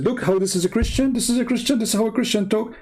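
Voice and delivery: high, incredulous mimicry of accusers; mocking, high-pitched pointing of the finger; mock accusing critics. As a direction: imitate outraged critics, pitched well up.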